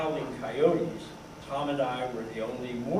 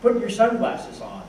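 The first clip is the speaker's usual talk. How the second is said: higher, louder order; commanding; 8 semitones higher, 7 dB louder than usual. A narrator's reading. Tom orders his younger friend about, commanding. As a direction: give it as a firm command. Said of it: "Tom commanded".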